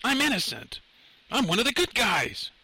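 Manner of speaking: high-pitched protest of innocence; desperate yelling. A narrator's reading. Lenny to the trooper shooting at him, high desperate yell. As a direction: call out pleadingly over the gunfire, pitch way up. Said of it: "Lenny yelled".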